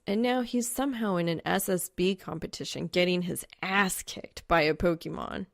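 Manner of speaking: ordinary delivery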